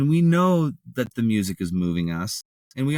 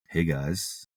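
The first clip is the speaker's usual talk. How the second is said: lower, softer smooth radio host; calm late-night radio DJ greeting; 5 semitones lower, 4 dB quieter than usual. Shown as a demonstration. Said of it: "You can do your radio D J voice".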